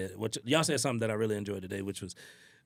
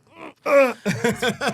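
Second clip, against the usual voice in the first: mock grumble; 13 semitones higher, 12 dB louder than usual. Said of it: "and you just like".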